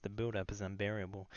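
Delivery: ordinary talk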